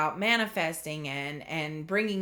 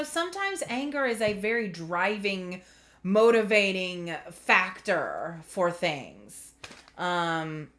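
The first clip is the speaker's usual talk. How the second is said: ordinary talk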